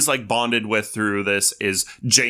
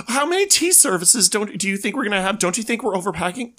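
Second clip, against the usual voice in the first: much higher, exasperated mock whine; fussy fretting partner; 10 semitones higher, faster than usual. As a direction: fret over the luggage in a high camp voice. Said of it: "It's like".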